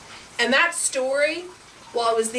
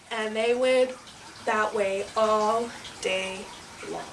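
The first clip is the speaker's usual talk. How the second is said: winding-down ending; 2 semitones lower, 5 dB quieter than usual. A narrator's reading. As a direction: let the tale drift away. Story winds down to close.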